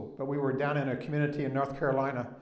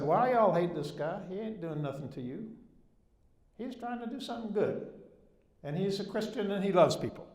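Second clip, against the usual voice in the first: put-on deepest Southern accent; 6 semitones higher than usual. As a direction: play the country Southern boy talking down an angry crowd. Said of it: "in my deepest Southern accent".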